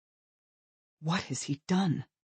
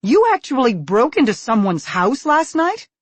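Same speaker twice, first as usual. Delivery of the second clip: loud, high, aghast question; can't believe what you hear; 10 semitones higher, 10 dB louder than usual. Acted. Shocked mother questions her son, aghast and loud.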